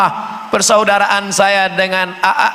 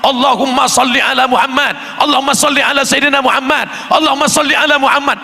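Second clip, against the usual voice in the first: frightening preaching; 6 semitones higher, faster than usual. Retold or imitated. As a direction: rattle it off fiercely enough to scare. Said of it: "Orang dengarnya ketakutan". (selawat cepat, keras dan tinggi yang menakutkan)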